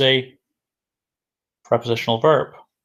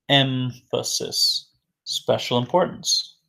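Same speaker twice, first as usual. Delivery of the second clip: "emphasis" drawn out slowly; slow repeat; slower than usual. Shown as a demonstration. Teacher repeats a new word for learners, slowly drawn out.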